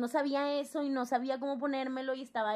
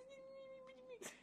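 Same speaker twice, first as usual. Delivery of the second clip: teary yes; 21 dB quieter than usual. Retold or imitated. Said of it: "Y yo".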